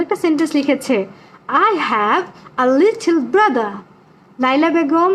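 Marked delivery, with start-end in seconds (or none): 1.5-3.7 slow, word-by-word sentence reading; drawn-out, careful repeat; slow model reading. Shown as a demonstration. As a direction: spell it out slowly for the class. Repeats pupil's sentence for the class.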